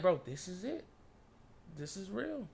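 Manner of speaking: softly, trailing away almost to nothing; hushed disbelief. Retold or imitated. Storyteller recalls realising it was real, hushed disbelief. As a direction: let it sink in quietly. Retelling his stunned realisation.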